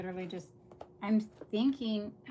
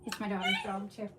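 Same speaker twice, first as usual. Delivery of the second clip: normal talking voice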